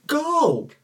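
high, loud mock parent shout; exasperated yell. Parent answering a child's potty request, exasperated yell. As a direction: yell it high at a kid who needs permission.

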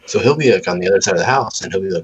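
ordinary talk, nothing marked

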